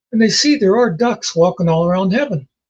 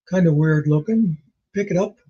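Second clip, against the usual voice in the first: ordinary delivery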